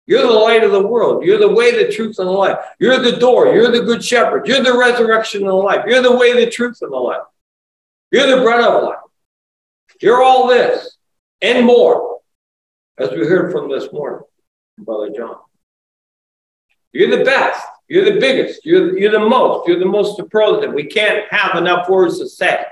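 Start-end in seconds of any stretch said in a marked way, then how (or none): 0.0-12.2 fervent preaching. Acted. Preacher voicing the congregation's praise of Christ, ringing proclamation. 16.9-20.7 fervent preaching. Acted. Preacher voicing the congregation's praise of Christ, ringing proclamation.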